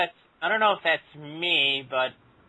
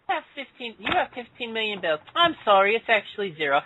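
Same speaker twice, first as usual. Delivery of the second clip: higher-pitched posh British voice; posh British accent; 5 semitones higher than usual. Pretend. Friend reimagining the pelican's bank line, posh British accent. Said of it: "like, a posh British accent".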